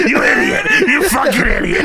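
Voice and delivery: high, hammed-up telling-off; laughing mock outrage; mock scolding. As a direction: berate him for laughs. Acting out Vince scolding his performer.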